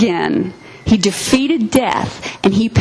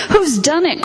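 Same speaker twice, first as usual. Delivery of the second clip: put-on higher, urgent child's question; distressed cry; 8 semitones higher than usual. A narrator's reading. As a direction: call it out high in alarm. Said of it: "cried Susan".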